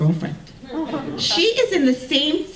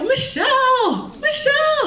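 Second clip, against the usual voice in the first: high, drawn-out yell across the hill; yelling a name; 4 dB louder, slower than usual. Retold or imitated. Runner yelling to her friend at the hill, long high shout. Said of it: "And I yell".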